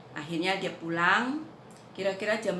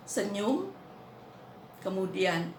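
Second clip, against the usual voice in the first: ordinary talk, nothing marked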